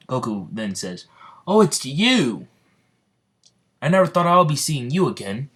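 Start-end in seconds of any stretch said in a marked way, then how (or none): 1.5-5.5 high, loud exclamation of recognition; excited greeting. Acted. Goku recognizes Midoriya, excited high greeting. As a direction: burst out on recognizing an old acquaintance.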